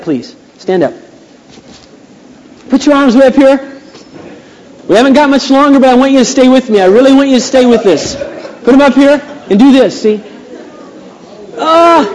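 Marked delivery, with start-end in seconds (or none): 2.7-10.2 high, raised-voice rallying call; rousing preacher. Real, not acted. Preacher getting the congregation to stretch and stay with him, rousing. 11.6-12.1 high, exaggerated yawn; big put-on yawn out loud; demonstrated yawn. Shown as a demonstration. Preacher showing a tired congregation how to yawn, exaggerated yawn.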